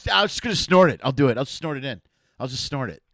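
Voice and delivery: loud, eager mock bravado. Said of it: "You're like".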